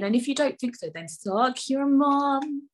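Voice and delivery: hammed-up cheeky taunt; mock diss. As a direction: fling the insult playfully.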